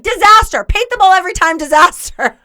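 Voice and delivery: high, loud, emphatic verdict; over-eager, punchy declaration; blurted outburst. Acted. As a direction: blurt it before you can think. Acts out her blurted answer.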